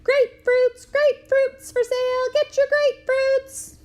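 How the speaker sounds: high, loud, slow hawker's cry; street vendor yell. Retold or imitated. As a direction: bellow it from the street corner. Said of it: "yell at the top of my voice".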